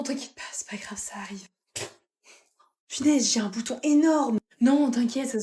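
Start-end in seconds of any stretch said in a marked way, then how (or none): 0.0-1.5 soft consoling whisper. Acted. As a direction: whisper it gently, like a secret. Consoling a friend after burp. (chuchoté pour rassurer son amie)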